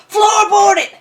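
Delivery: sudden shouted command, high and loud; retold yell. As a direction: bark it like a panicked getaway order. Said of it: "and he yells".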